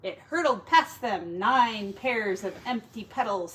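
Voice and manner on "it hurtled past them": plain reading voice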